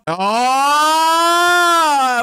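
long high jungle yell, held out loud; Tarzan yell. Pretend. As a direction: let out a full Tarzan call swinging across.

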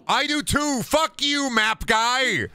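high startled yell; scared horror game streamer. Real, not acted. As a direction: shriek at the robot. Startled by map robot.

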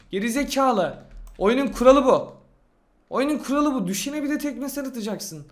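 heated comeback to an insult; indignant scolding. Real, not acted. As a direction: tell off a hater, pitch pushed up. Scolding a commenter who insulted him.

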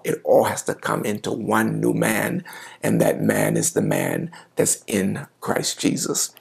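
normal talking voice

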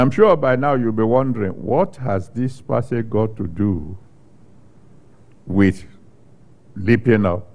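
ordinary delivery

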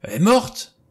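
high, loud cry of shock; shocked exclamation. A narrator's reading. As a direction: blurt it out, pitch leaping up. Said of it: "s'exclama Blore".

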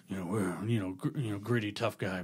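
low, gruff tough guy voice; gritty tough guy voice. Retold or imitated. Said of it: "Like my voice".

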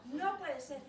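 loud flat refusal; the king refusing his daughter, loudly; outraged king. Acted. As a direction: slam it shut. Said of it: "the king again".

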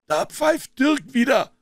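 high, drawn-out cry; called-out warning. A narrator's reading. Gang member hearing signal, called-out warning. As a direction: alert the gang slowly and loudly.

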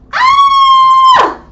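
long, very high, loud scream; sustained full-volume shriek on "ah". Shown as a demonstration. Teacher acts out the command to scream, piercing high scream. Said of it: "Y grita".

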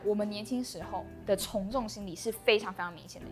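ordinary talk

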